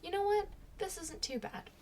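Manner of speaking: high, bright relieved surprise; pleasantly surprised. Retold or imitated. On the plane, relaxed, high surprised relief. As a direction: sound amazed it's going fine. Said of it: "I was like".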